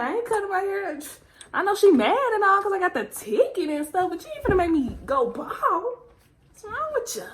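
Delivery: high, indignant defiance; defiant. Retold or imitated. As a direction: protest that she won't go bald. Defiant inner thoughts.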